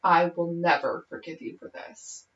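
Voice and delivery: low, hushed, controlled delivery; composed, cold vow. Retold or imitated. Blaine looking right at Kurt after nearly crying, quietly composed. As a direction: imitate quiet unforgiving finality. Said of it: "sort of regains composure".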